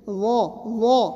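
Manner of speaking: وا, وا broken and said very slowly; slow teaching وا; slow syllable demo. Shown as a demonstration. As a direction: sound out وا step by step. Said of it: "جیسے".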